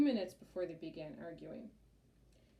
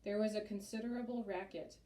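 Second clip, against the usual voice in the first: plain reading voice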